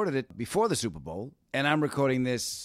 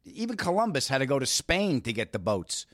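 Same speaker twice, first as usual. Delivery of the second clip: usual speaking voice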